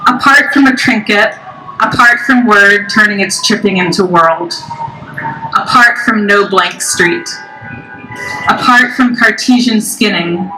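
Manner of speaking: measured poem reading with pauses between lines; solemn recital cadence; poetry reading. Acted. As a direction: read each phrase deliberately, letting it fall and rest. Reads own poem to audience.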